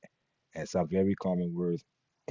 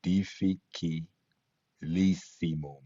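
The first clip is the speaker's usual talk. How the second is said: Difficilissimum said slowly, each syllable apart; much slower than usual. Shown as a demonstration. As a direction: break Difficilissimum into slow syllables. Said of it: "when we break down syllables".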